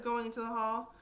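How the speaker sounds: ordinary delivery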